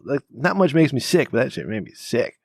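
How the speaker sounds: usual speaking voice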